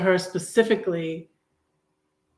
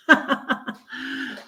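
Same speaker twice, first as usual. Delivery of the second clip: laughing; 8 semitones higher than usual. Real, not acted. Laughs about her story.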